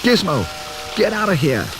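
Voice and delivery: Billy warns Gizmo away; shouting. Acted. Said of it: "shouted Billy".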